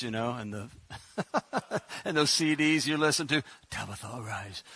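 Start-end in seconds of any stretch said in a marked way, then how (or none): 3.7-4.6 low, soft, solemn King James reading. Retold or imitated. Preacher mocking the reverent Bible reading as a hushed King James voice. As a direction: intone it like an old Bible recording. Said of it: "you hear it in the King James voice".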